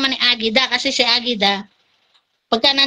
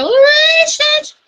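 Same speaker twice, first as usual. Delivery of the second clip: mock squeal, high and loud; excited high call; 13 semitones higher, 4 dB louder than usual. Calling out to a friend, a high playful squeal.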